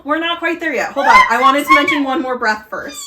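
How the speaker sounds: high, loud, eager interruption; excited. Real, not acted. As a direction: jump in excitedly to hold them off.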